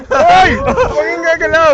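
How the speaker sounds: high, loud panicked shout; scared yell. Real, not acted. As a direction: cry out in a real fright. Scared on rocking raft, yelling.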